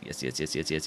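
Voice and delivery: ordinary delivery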